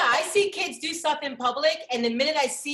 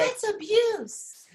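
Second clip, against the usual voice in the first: mock outraged bystander; 8 semitones higher, much slower than usual. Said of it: "Now somebody be like".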